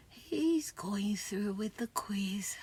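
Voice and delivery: slow conspiratorial whisper; hushed aside. Acted. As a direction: lean in and whisper it slowly.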